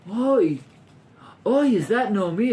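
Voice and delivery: high, pained oi of concern; sympathetic shock. Shown as a demonstration. Teacher shows what townspeople did not feel, compassionate shock. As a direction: cry out as if sorry to see it. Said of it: "but it's not shocked and go".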